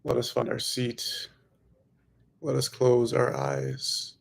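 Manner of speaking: ordinary talk, nothing marked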